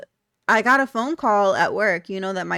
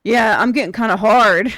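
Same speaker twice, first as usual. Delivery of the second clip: louder mock of a creepy caller; loud retold caller; 10 dB louder than usual. Imitating a creepy phone caller for viewers, loud mock role. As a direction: blurt it out loudly as the imitated caller. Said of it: "what he was saying was".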